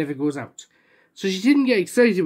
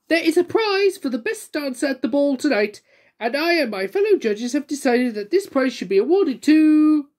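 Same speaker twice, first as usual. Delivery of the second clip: much higher, grand announcement; grand hostess; 7 semitones higher than usual. Acted. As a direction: make it a splendid occasion. Splendid announces the prize.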